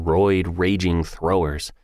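ordinary delivery, nothing marked

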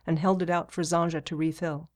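ordinary delivery

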